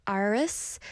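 "Iris" with the tongue rolled on the r. Shown as a demonstration. Showing viewers how she says her name, "Iris" with rolled tongue. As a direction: curl your tongue into the r of "Iris". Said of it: "I kinda roll my tongue on the".

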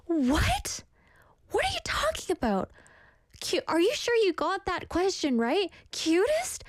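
high-pitched, exaggerated disbelief; mock shock. Acting out her reaction to not being picked as cutest, mock outrage. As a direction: act out incredulous protest, pitch leaping up.

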